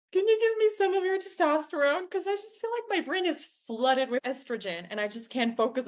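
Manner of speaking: high plea easing down toward normal pitch; pleading request. Retold or imitated. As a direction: start high and pleading, then let it settle. Retold plea to her mentors.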